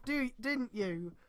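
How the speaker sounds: usual speaking voice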